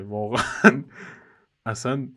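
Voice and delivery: laughing. Real, not acted. Laughing at own long sentence.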